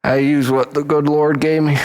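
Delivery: ordinary delivery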